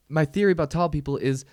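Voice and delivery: ordinary delivery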